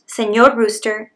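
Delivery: ordinary delivery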